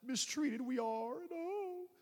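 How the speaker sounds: high, slow self-pitying moan; put-on pitiful wail, trailing into a soft sob; mock crying. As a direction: whimper it in exaggerated misery. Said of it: "We sit and cry".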